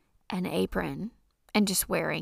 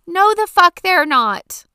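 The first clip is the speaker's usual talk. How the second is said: yelled rant; 9 semitones higher, 12 dB louder than usual. Real, not acted. Ranting about cleaning toilets.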